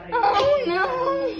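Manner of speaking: high, loud crying "oh, no"; sudden tearful cry. Real, not acted. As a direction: cry out in distress. Emotional outburst while singing.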